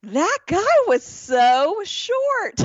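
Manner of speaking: high, slow and loud blurt; Amy blurting loudly about the clerk. Retold or imitated. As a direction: belt it out too loud. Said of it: "as loud as she can".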